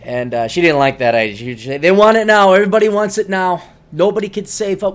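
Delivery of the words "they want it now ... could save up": louder, higher scoffing rant; hammed-up grumbling; mock complaint. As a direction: scoff at impatient spenders. Mocking impatient people.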